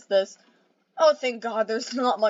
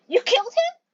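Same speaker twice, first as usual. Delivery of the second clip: shocked disbelief; 13 semitones higher, 4 dB louder than usual. Acted.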